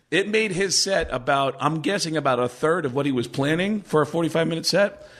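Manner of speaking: normal talking voice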